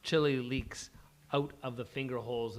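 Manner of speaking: ordinary delivery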